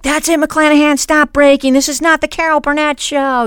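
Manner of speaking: high-pitched imitation of an exasperated coach; mock-scold a student who keeps breaking. Acts out coach scolding her.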